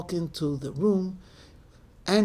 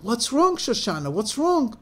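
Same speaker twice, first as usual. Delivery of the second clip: higher-pitched alarmed questions; worried urgent questioning; 6 semitones higher than usual. Acted. Mother running in to crying daughter, alarmed questioning. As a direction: demand anxiously to know what's wrong.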